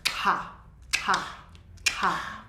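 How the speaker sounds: unvoiced breathy ha ha ha; breathy huffs. Shown as a demonstration. Teacher demonstrates breathing out from the back. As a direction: push breath out from the back of the jaw. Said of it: "You're breathing again".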